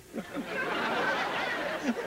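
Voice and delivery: giggle fit. Real, not acted. Host cracks up on air.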